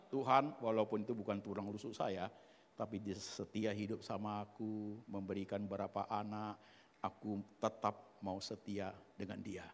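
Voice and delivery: low, measured, humble vow; acted sincere prayer. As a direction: pray it earnestly, pitch dropped. Acting husband's sincere prayer to God.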